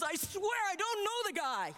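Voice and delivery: high, acted-out protest; vehement denial. Peter denies Jesus a last time, vehement denial. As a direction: swear it off, pitched well up.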